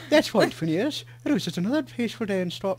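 accidental Cockney-sounding attempt at Minnesota; attempted Minnesota accent. Shown as a demonstration. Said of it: "that's like Cockney or something".

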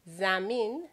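زمین as zah-meen, rising; Farsi word clearly. Shown as a demonstration.

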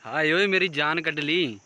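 drawn-out, loud cry of 'hai oye'; loud exclamation. Real, not acted. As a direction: exclaim it loudly and slowly. Exclaiming at the view. (ज़ोर से, खींचकर आह भरी पुकार)

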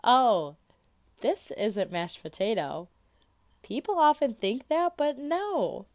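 loud, high excited pitch; eager salesman. Acted. Charlie sells mashed potato as cloud fluff, loudly. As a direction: sell the trick with gusto.

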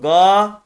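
"Ga" with a long, held a; lengthened vowel. Shown as a demonstration. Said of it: "burada elif uzatıyor".

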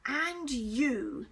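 menacing stern hiss. A narrator's reading. Teacher turns on Michael, hissing. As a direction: hiss it at the culprit. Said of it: "he hissed at Michael".

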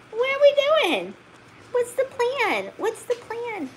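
very high sing-song coo at the puppy. Real, not acted. Owner cooing at her wandering husky puppy, very high baby talk.

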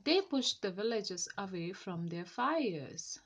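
usual speaking voice

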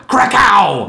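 loud booming Krakow for thunder; thunder sound. Shown as a demonstration. Demonstrating Thor's thunder, loud Krakow!. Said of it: "the sound of thunder".

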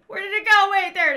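loud, high, alarmed calling out; sudden loud alarm at the game; startled shout. Real, not acted. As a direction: yell in a sudden panic. Startled search in dark game.

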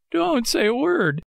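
high, slow frightened puppet voice; trembling with fright. A narrator's reading. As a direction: plead slowly and high, still scared. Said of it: "still trembling with fright".

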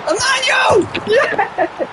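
high shouted name and cheer; goal celebration. Real, not acted. Streamer celebrating Emmanuel's goal, high joyful shout. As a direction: explode with joy at the goal.

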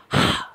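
one loud breathy হা from the throat; h sound demo. Shown as a demonstration. Teacher shows students the bare h sound. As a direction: push out a single loud হা. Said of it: "শুধু হা করো".

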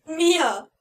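high, loud call of her name. A narrator's reading. Narrator calling out to his captive sister, loud. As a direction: call out to her across the clearing. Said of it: "rief ich".

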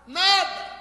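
high, questioning call then repeat; mock shouted echo; acted shout. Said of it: "E ele grita".

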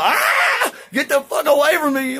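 shrieking very high and loud in panic; terrified scream. Acted. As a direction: scream and run for your life. Slaves fleeing Samson in terror.

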